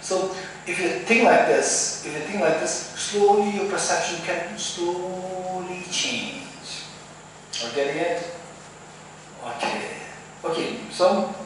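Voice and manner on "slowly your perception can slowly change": slow, elongated delivery, almost sung; slowly drawn out. Shown as a demonstration. Teacher showing students perception changing gradually, slowly drawn out. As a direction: stretch the words out, lingering on "slowly".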